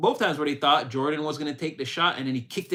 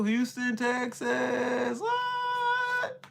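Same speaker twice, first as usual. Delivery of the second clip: high squeal of disbelief; a high, startled what; shocked; 8 semitones higher, much slower than usual. Real, not acted. Surprised at viewer's time zone.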